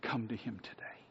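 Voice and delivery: soft whispered invitation; pleading whisper to the listener. Real, not acted. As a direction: whisper the call, barely voiced. Closing altar call.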